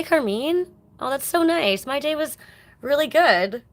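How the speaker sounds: normal talking voice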